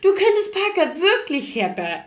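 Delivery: high, incredulous interjection; amazed. A narrator's reading. Ray breaks in about summoning Parker, amazed voice. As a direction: cut in with high-pitched amazement.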